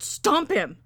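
high, loud frantic command; shouted order. A narrator's reading. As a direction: yell it at your helper. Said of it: "she ordered".

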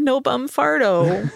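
slow, high-pitched mock patient complaint; patient at doctor. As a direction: tell the doctor your trouble, hammed up. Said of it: "Or you go into the doctor".

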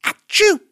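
loud high cartoon sneeze; big sudden achoo. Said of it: "Buster sneezed".

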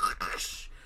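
voiceless crashing sound effect; imitated crash noise. Film narrator showing the noise outside, imitated crash. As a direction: make a rushing crash with the breath. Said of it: "you hear a crash".

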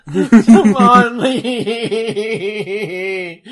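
drawn out through laughter; laughing. Real, not acted. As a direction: stretch it out while cracking up. Repeating joke name through laughter.

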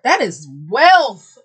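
loud, slow emphatic; loud exclamation. Real, not acted. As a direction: proclaim it slowly. Exclaiming about rich collectors.

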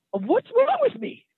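very high, slow, squeaky cry of disbelief; annoyed self-question. Retold or imitated. Driver asking himself on the freeway, high and incredulous. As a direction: stretch it way up in a strained falsetto. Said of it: "'cause I was so annoyed".

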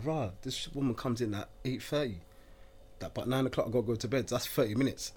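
quiet grumble. Retold or imitated. Inner complaint quiet.

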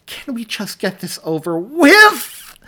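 high, loud, slow strained plea; strained pleading. A narrator's reading. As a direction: push it out through the pain. Mary in labor, desperate.

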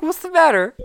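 hammed-up sweet girlfriend asking; imitating the girlfriend.

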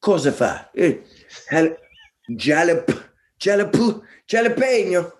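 mock Italian accent, climbing high on "jalapeno"; imitated Italian friends. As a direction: stumble over it as a baffled Italian, pitch rising. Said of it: "I have Italian friends that would be like".

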